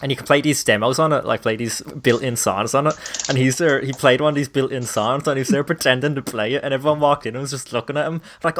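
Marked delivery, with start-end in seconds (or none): none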